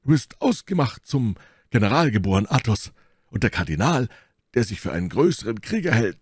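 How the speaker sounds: exclaiming praise, high and swinging; Porthos. A narrator's reading. Porthos praises Athos as a born general, exclaiming. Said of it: "rief Porthos".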